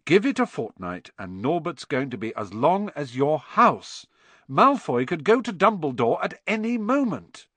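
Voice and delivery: loud. A narrator's reading. Harry warning Hagrid about the dragon, loud. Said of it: "said Harry loudly".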